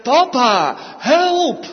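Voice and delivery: put-on high, loud panicked call of a little boy; child crying for help. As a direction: cry out to daddy in sudden need. Little boy calls to his father.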